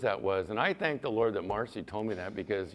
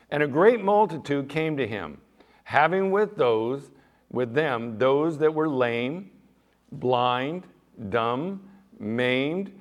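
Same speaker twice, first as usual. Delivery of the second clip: louder, measured Bible reading; scripture reading; 2 semitones higher, 4 dB louder than usual. Acted. Preacher reading Matthew to the congregation, loud and measured. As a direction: read the Gospel out with weight.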